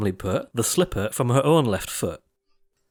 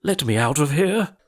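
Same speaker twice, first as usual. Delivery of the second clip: plain reading voice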